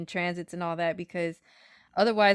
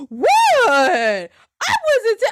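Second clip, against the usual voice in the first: higher, louder defensive cry; mock protest; 13 semitones higher, 10 dB louder, much slower than usual. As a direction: protest you meant no harm. Friend protesting.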